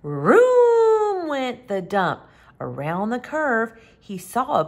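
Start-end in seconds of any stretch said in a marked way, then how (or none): 0.0-2.2 vroom. Acted. Truck roaring past.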